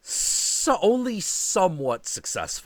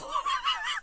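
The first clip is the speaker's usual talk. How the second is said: high squeaky laugh; 6 dB quieter than usual. Real, not acted. Laughing fit.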